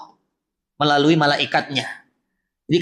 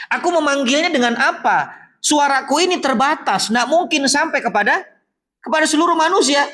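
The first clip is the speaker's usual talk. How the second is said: higher, pleading voice of Ibrahim asking the Lord; 7 semitones higher than usual. Retold or imitated. Preacher voicing Ibrahim asking God how to call, higher and pleading. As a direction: plead as a prophet whose voice cannot reach everyone. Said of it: "Lalu Ibrahim bertanya".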